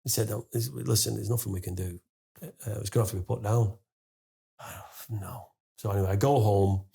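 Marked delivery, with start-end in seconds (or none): 0.3-3.8 low, soft, apologetic vet; gently breaking bad news. Vet telling owner the cat must go, low and soft. 4.6-5.5 sighed, very quiet and low; dismayed. Retold or imitated. Owner hearing his cat must be put down, hushed dismay. Owner hears the bad news.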